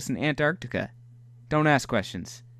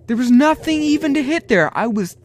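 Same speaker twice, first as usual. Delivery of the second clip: high, loud complaining; complaining the game cheated him; indignant protest; 9 semitones higher, 7 dB louder than usual. Real, not acted. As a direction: protest the unfair hit.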